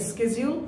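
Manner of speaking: schedule said as SKE-jyul; common variant. Shown as a demonstration. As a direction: start schedule with a hard sk. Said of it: "অথবা বলি". (schedule-এর শুরুতে স্ক)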